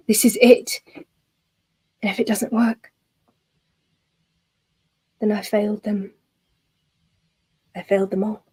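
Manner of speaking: lightly voiced figure, whispering in despair; a hint of a hushed, broken man; desperate young mage. A mage alone with his failure, murmuring in despair.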